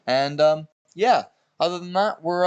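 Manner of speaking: ordinary delivery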